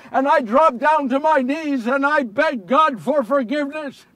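anguished confession. Acted. Relives begging God for forgiveness.

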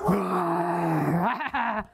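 low growl, lightly played; growling lion. Lion growling at the monkey, low and playful.

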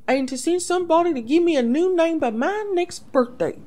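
put-on a girl fumbling a lie; nervous young princess. Princess asks for new name.

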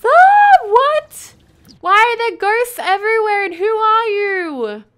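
high scream into loud, bewildered questions; shriek, then demand answers in alarm; loud, high-pitched panicky surprise; startled. Real, not acted. More ghosts appear suddenly.

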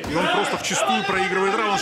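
excited. Real, not acted. Commentator exclaims as fighter loses.

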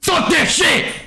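high, frustrated shout; real high-pitched gamer rage; angry outburst. Real, not acted. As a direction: shout it out, fed up. Frustrated venting at the game.

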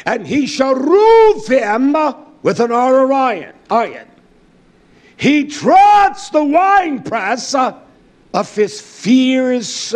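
loud, high, impassioned sermon; rousing pulpit preaching; fiery preaching. Acted. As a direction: preach it out to the congregation. Preaching at full force.

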